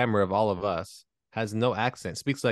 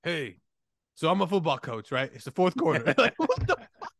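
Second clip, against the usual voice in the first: high-pitched comic disbelief; hammed-up shock at a man come alive; mock amazement; 8 semitones higher, 3 dB louder than usual. Acting out reactions to the awakening.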